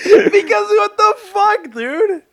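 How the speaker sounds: high, slow, loud disbelief; incredulous. Real, not acted. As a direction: drag it out in disbelief. Roasting friend about pig.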